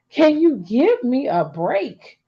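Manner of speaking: slow, high laughing plea. Real, not acted. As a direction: laugh through the words, drawn out. Laughing plea about being judged.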